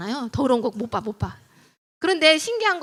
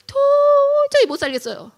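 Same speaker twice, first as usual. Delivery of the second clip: high, loud, long-drawn first word; acted husband at his limit; exasperated outburst; 13 semitones higher, 7 dB louder, slower than usual. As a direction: stretch it out like someone at breaking point. Voices husband at breaking point.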